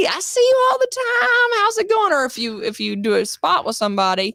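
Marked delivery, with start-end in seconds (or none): 0.1-2.1 high, chummy greeting to a familiar face; higher, cheery hello; audition friend. Retold or imitated. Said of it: "when you go to an audition".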